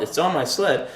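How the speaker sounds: usual speaking voice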